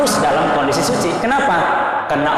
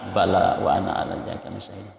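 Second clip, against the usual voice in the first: much softer, lower, under the breath; soft murmured response; 5 semitones lower, 8 dB quieter than usual. Shown as a demonstration. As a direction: murmur the answer quietly to yourself. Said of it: "walaupun nggak terlalu kuat".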